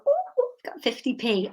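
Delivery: a hint of a six-year-old showing off her coin; little girl voice. Child self delighted by coin.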